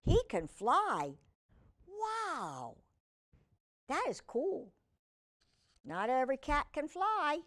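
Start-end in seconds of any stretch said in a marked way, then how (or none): 1.9-4.7 wow. Real, not acted. Reacting to flying cat.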